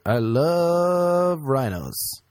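slow, drawn-out mock sincerity; mock rhino lover. Mocking a hunter posing with a dead rhino, slow mock sincerity. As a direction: ham up a fake animal lover. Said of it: "That's like being like".

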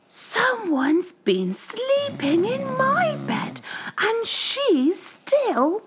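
very high, slow whispered cry of a cub; Baby Bear whispering. A narrator's reading. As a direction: whisper it in shock like a tiny cub. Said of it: "cried Baby Bear".

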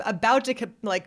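ordinary delivery

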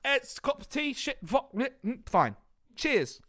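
fumbling through British clichés; mock British accent. Riffing on Brits with his cohost, a lightly mocked British accent. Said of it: "a British accent?".

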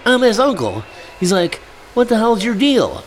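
high indignant voice; high hammed-up outrage; mock indignant uncle. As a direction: bristle like a protective uncle.

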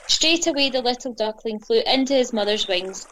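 ordinary delivery

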